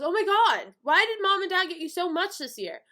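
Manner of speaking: loud, high complaint; jealous outrage. Retold or imitated. Brother seeing sister's pile of presents, jealous outrage. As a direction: whine in loud disbelief.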